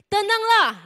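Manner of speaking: high, loud call to be calm. Acted. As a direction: call out over the storm. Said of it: "Yesus berkata kepada mereka".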